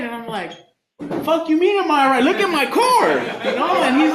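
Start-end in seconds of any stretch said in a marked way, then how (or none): 1.2-3.1 loud, high comic outrage; indignant retort. Retold or imitated. Man answering stranger who asks if he's all right, indignant. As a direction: fire it back incredulously, pitch jumping up.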